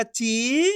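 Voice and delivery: ची held long with a rising tune; drawn-out syllable. Shown as a demonstration. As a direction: stretch ची out, letting it rise. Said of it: "एक एक अक्षर का उच्चारण". (ची को खूब खींचकर)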